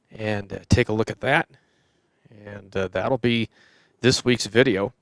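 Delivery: ordinary talk, nothing marked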